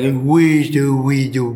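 put-on cooing sing-song baby talk. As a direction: coo it like talking to an infant. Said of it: "try to talk to babies in a baby voice".

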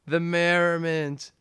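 high, loud, drawn-out lament; hammed-up outburst. Suspect bursts out listing his misdeeds, hammed-up outburst. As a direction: stretch each item into an imitated wail.

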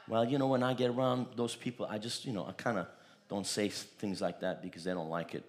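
timid excuse. Retold or imitated.

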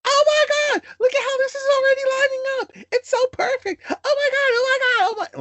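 very high, loud, fast gushing squeal. Retold or imitated. Pastor's wife thrilled the match lines up: shrieking squeal. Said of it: "pastor's wife is just like this".

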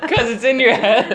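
laughing loudly; giggling through words. Real, not acted. Still laughing.

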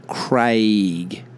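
"Craig" with a long "ay", "Crayg"; long A sound. Shown as a demonstration. Explaining the spelling rule, long A. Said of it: "make an A sound".

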